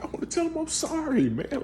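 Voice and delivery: high, strained, choked-up plea; tearful apology. Retold or imitated. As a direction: apologize with the voice pushed high by tears. Tearful apology to child self.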